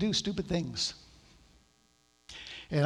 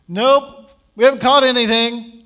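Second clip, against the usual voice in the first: much louder, higher curt answer; shouted reply; 6 semitones higher, 14 dB louder than usual. Retold or imitated. Peter calling back to the man on shore, loud. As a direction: yell it back across the water.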